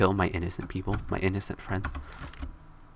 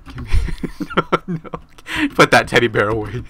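laughing protest; 2 semitones higher, 6 dB louder than usual. Pretend. Laughing while ordering player.

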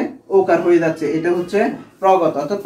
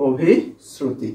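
drawn out slowly, every syllable clear; slow term; 5 semitones lower, much slower than usual. Shown as a demonstration. Teacher naming the term for students, slowly. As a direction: spell out Abhishruti slowly for the class. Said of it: "তাহলে এটাই হচ্ছে কি?".

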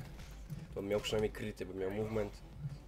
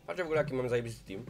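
normal talking voice

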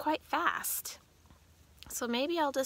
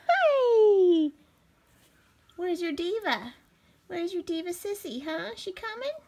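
pet talk; 7 semitones higher, 7 dB louder than usual. Real, not acted. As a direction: coo at the little dog. Talking to the dog.

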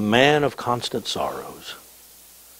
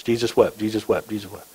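quick, tossed-off repeats, dropping away; rapid repetition; 4 dB quieter than usual. Shown as a demonstration. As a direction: rattle off the short verse, fading at the end. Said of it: "you can do that in about ten seconds".

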